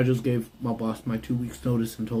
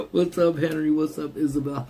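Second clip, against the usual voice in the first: bright, louder hello; excited greeting; 5 semitones higher, 4 dB louder than usual. Real, not acted. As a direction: call out a cheerful hi. Greeting chat arrivals.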